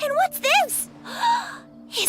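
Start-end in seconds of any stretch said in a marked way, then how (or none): 1.1-1.6 sharp high gasp of delight; a quick thrilled intake of breath; excited gasp. Acted. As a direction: gasp at something new and shiny. Child spots new toy.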